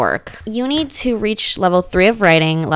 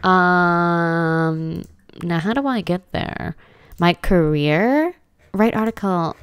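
normal talking voice

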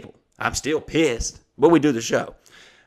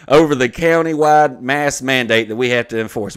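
louder, punched-out official wording; email read-aloud; 7 dB louder than usual. Acted. Venue citing its mask mandate, loudly read aloud. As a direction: declaim the written accusation word by word. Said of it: "I'm gonna read the email".

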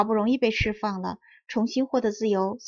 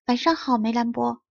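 ordinary delivery

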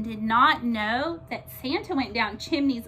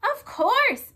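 high, loud yes; eager moose; 12 semitones higher, 6 dB louder than usual. A narrator's reading. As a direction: answer happily. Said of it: "said Milton".

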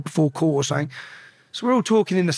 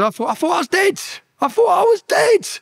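high, strained scream, building louder; retold screaming; 13 semitones higher, 3 dB louder than usual. Prisoner just locked back in his cell after the fight, screaming. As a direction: scream it out, climbing higher on the repeat. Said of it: "I remember just screaming".

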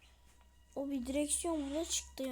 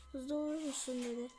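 ordinary talk, nothing marked

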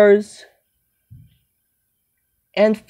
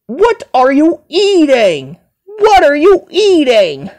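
higher-pitched, repeated demanding question; insistent scolding; 5 semitones higher than usual. Acted. Scolding someone over junk food, insistent demand.

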